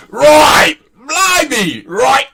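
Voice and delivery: high, shouted mock Cockney; British accent. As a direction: squeal out a hammy Cockney. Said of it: "the Queen's English".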